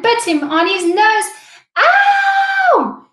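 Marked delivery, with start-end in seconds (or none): 1.8-3.1 high, loud yelp of pain; pained yell. A narrator's reading. Rhino bitten on the nose by Mosquito, high pained yell. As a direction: shriek as the bite lands. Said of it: "yelled Rhino".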